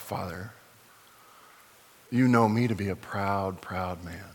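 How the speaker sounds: soft, low humble prayer. Real, not acted. Pastor prays before the congregation in a soft, low voice. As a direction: confess quietly to God.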